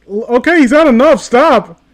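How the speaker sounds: pleading shout. Real, not acted. Watching the fight drag on, shouting stop. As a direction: yell at the screen to call off the beating.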